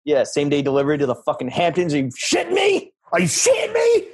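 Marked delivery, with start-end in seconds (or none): 1.9-2.9 high put-on bro voice, outraged; mock outraged bro. Mocking rich Hamptons guy. 3.1-4.1 outraged shout. Pretend. Mocking rich Hamptons guy.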